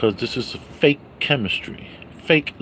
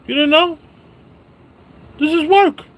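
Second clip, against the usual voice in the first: This is work squeaked up high and louder; high squealed insistence; 6 dB louder than usual. Acted. Vlogger insisting streaming is work, falsetto.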